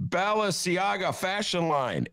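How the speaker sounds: ordinary delivery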